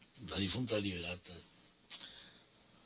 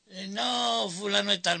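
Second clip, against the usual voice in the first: higher, louder mother's voice; compadre's mother; 10 semitones higher, 6 dB louder than usual. Retold or imitated. Mother turning away the caller at the door, higher and louder. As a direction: brush the caller off as the protective mother. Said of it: "me decía la mamá".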